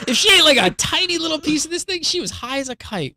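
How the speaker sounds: excited high-pitched telling. Real, not acted. Telling the hosts about his five-pound dog, excited and high.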